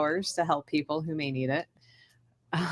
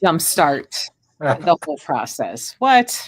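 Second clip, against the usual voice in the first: mock protest; 5 dB louder, slower than usual. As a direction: object as if the slow route is absurd. Jokingly protests the advice to go slow.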